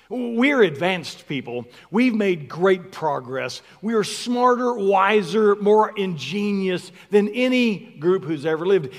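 ordinary talk

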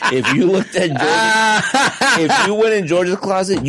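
amused, giggling while talking about a friend; laughing. Real, not acted.

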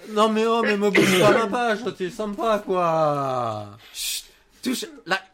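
loud laughing telling-off; playful scolding. Real, not acted. Scolding someone grabbing the mouse.

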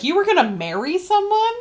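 high-pitched retold shock; incredulous. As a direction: blurt it as an astonished question. Retells Charming's shocked question to Emma.

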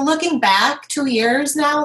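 ordinary talk

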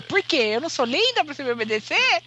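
high, indignant mock girlfriend voice; imitating the girlfriend. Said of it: "ela vai falar".

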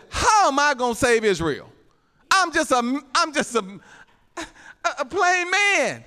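high-pitched, hammed-up helpless complaint; pleading protest. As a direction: plead inadequacy, stammering on the false starts. Acting out Gideon's protest to God.

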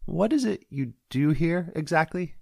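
a pointed, drawn-out question; Tammy's probing voice. Acted. Deputy quizzing the sheriff at the diner, high and slow.

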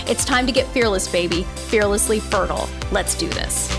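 punchy hype podcast intro; promo announcer read. Acted. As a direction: sell it like a radio promo. Podcast intro read over music.

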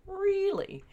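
high-pitched incredulous question; amused disbelief. Retold or imitated. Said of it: "I'm laughing, going".

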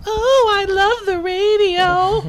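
high, slow, loud mock sob over the radio; mock crying gush. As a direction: wail your love like a hammed-up fan. Hammed-up gushing.

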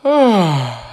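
long, loud yawning "ah"; waking yawn. A narrator's reading. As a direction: stretch and yawn it out, falling away. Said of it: "hat er sich gestreckt und geeinert".

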